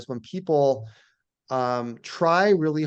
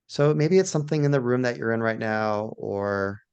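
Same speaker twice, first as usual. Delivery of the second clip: guided meditation; 2 semitones lower than usual. Shown as a demonstration. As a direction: gently guide listeners into awe, phrase by phrase. Leads a guided awe meditation.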